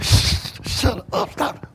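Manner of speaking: soft hissed shush; hushing. Acted. Crowd hushing the blind man, soft hiss. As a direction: hush him quietly under the breath. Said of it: "trying to tell him to shut up".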